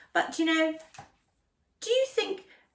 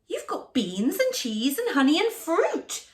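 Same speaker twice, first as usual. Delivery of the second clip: higher, louder delighted mouse; excited mouse; 7 semitones higher, 5 dB louder than usual. Acted. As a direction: marvel at the feast. Country Mouse amazed at food.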